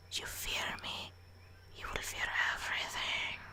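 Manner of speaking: soft, breathy menace; whispered threat. Acted.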